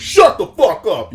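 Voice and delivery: imitating a rude shout; mock yell. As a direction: bark it like the guy in the back. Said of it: "one of the dudes in the back goes".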